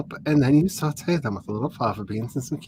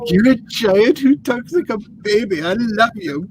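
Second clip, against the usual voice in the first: gushing; 9 semitones higher, 6 dB louder than usual. Retold or imitated. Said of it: "I was like".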